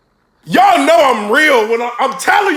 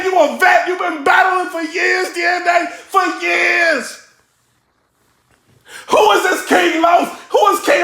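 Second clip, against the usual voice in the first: panicked yelling at the TV; 6 semitones higher than usual. Real, not acted. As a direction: beg the rapper to fight back.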